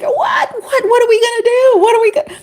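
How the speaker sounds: high-pitched mock wail of panic; tearful panic. Wife retelling her tears alone at home, high mock-tearful panic. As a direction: replay a tearful fret, voice jumping way up. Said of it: "and I was in tears".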